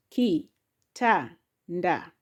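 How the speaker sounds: "Ki, ta, nda" with short gaps; syllable by syllable. Shown as a demonstration. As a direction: tap out "Ki", "ta", "nda" one at a time. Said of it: "has syllable".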